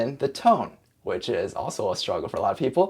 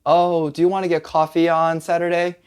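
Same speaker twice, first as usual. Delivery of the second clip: higher, even-pitched asking; higher demo question; acted casual invitation; 5 semitones higher, 4 dB louder than usual. Shown as a demonstration. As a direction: lightly act out inviting a friend.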